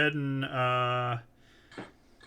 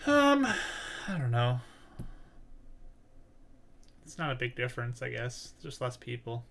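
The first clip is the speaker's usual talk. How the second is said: lightly voiced reserved character; 2 semitones lower, 5 dB quieter than usual. [name] answers about missing the city, lightly voiced reserved character.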